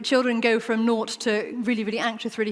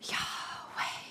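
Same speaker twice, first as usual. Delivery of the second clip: a name breathed out in a whisper; breathy whisper; 14 dB quieter than usual. Shown as a demonstration. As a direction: whisper it as a long, soft breath. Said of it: "that is like a breath".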